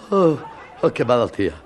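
ordinary talk

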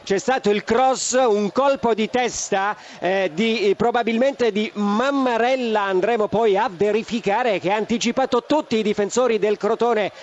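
ordinary delivery

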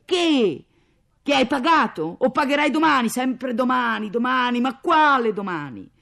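loud, high, scornful outburst; furious shout. Acted. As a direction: cut off a feeble excuse furiously. Mother explodes at husband's excuses. (urlo furioso e sprezzante)

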